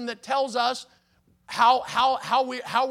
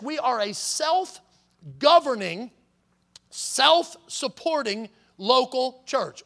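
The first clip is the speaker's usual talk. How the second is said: very slow, each word laid out; deliberate; 4 dB louder, much slower than usual. Real, not acted. Pastor defining autonomy for the congregation, slow. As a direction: spell out the definition carefully.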